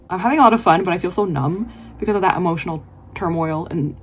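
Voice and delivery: normal talking voice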